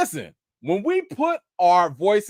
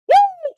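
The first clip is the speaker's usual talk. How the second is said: high, loud woo; joyful whoop; 8 dB louder than usual. Real, not acted. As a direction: whoop in triumph.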